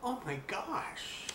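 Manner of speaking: hushed, slow thinking aloud; inner thought. A narrator's reading. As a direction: breathe it to yourself in shock. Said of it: "then thought".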